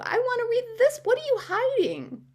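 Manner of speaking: high-pitched suspicious demand; retold protest, voice shooting up; indignant protest. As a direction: act out refusing to give it up. Retells her protest over the book.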